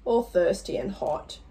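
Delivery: plain reading voice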